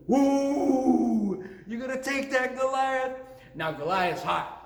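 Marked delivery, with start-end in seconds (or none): 0.1-1.4 loud, high, long "woo"; jeering crowd whoop. Retold or imitated. Philistines egging on Goliath, high jeering whoop. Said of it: "all the Philistines went". 1.7-3.2 high-pitched crowd taunt; teasing jeer. Retold or imitated. Philistines egging on Goliath, teasing jeer. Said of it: "they're teasing David".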